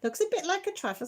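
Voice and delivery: a hint of imitating a viewer; quoting Emma. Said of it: "Emma said".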